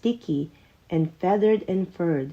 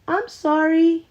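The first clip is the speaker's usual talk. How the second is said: spluttered; 10 semitones higher, 4 dB louder than usual. Acted. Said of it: "he spluttered".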